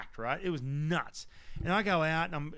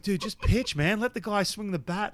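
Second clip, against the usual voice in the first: higher-pitched impatient urging; mild mock exasperation; 6 semitones higher than usual. Retelling reaction to repeated pickoff throws, exasperated. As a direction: lightly act out a fed-up onlooker. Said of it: "everyone's booing".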